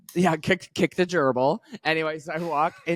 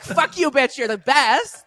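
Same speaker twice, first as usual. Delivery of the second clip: "Fuck you, bitch" shouted higher and louder as a fond compliment; affectionate loud praise; 11 semitones higher, 6 dB louder, slower than usual. Shown as a demonstration. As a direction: yell it like a delighted cheer for a friend. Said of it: "That's what I meant".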